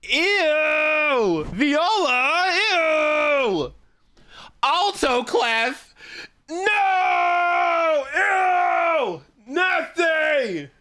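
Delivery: hammed-up high-pitched snob yelling ew; disgusted snob shriek. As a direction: shriek like a snooty violinist seeing a viola. Mocking violinists disgusted by viola.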